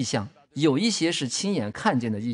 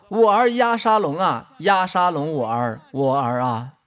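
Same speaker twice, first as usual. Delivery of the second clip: crying out loudly; 4 dB louder, slower than usual. Retold or imitated. Preacher voicing King David's lament for his son, crying out loudly. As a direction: call out slowly, loud at first, then drop. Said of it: "他大声呼喊说".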